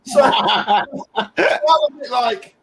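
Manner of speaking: high-pitched laugh through the words; giggling as you remember it. Real, not acted. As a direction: laugh your way into the line, slow. Laughing while retelling [name].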